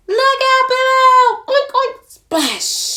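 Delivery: squealing high and loud as Pig cannonballs in. Acted. To the startled duck, Pig yells in a loud high squeal. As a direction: belt it out like a joyful pig diving.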